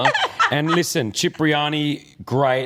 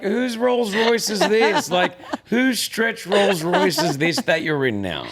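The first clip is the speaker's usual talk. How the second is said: mock suspicious; 7 semitones higher, slower than usual. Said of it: "And I'm like".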